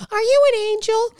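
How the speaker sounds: put-on high, sweet little-kid quote; put-on small boy voice, high and held; Anakin's audition line. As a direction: imitate a child actor's line, pitched high. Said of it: "four hundred interviews with Natalie at his side doing the".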